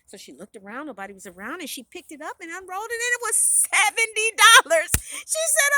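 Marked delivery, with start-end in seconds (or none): 3.4-4.9 loud, high, amazed reveal; thrilled discovery. A narrator's reading. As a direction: punch out the lucky find. Heroine finds unexpected money.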